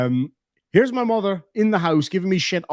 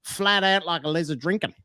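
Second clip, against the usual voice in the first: put-on Australian accent; 2 semitones higher than usual. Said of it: "another Australianism I liked".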